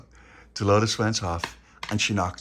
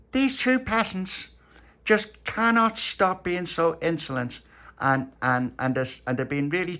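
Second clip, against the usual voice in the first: high, slow, drawn-out grievance; complaining queen; 8 semitones higher, 5 dB louder, slower than usual. Acted. Queen complaining to lady-in-waiting, slow and high. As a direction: lay out the grievance grandly.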